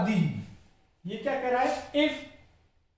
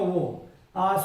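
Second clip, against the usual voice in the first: ordinary talk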